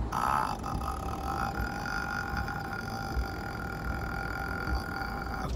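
deep creaky fry on a held vowel; low rattling creak, drawn out; vocal fry. Shown as a demonstration. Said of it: "呪音のなんかこういう、". (低いエッジボイス)

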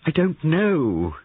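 high, loud, drawn-out protest; distressed cry. A narrator's reading. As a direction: cry it out slowly, at a loss. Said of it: "cried Molly".

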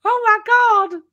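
very high, loud, swooning gasp of delight; mock Texas ladies swooning over him; hammed-up delighted squeal. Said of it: "And they go".

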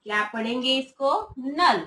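ordinary talk, nothing marked

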